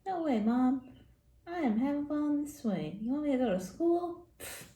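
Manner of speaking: put-on a child refusing his mom from the swing; defiant kid. Kid on the swing refusing his mom, lightly voiced defiance. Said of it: "He's like".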